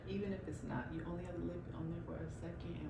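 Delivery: whispering. Real, not acted. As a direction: talk under your breath. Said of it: "Why are you whispering?".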